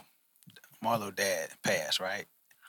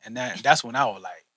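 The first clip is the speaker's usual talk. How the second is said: ordinary talk, nothing marked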